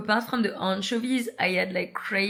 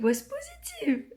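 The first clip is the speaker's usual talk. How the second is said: re-enacted crying. Reliving the positive test, crying.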